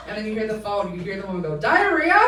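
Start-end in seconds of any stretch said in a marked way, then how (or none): 1.6-2.2 high, loud, incredulous squawk; shocked question. Retold or imitated. Woman on the phone echoing the cop, loudly incredulous. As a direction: shoot the question way up in disbelief.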